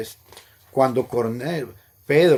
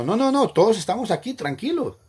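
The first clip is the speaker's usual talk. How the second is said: higher, louder and fast urgent call; retold shout; 9 semitones higher, 4 dB louder than usual. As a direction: call out fast to stop him. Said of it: "Pablo le gritó". (Pablo grita con urgencia, más alto y rápido)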